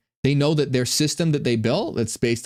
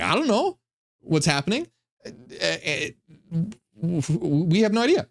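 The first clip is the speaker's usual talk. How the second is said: clueless archons; 4 semitones higher than usual. Acted.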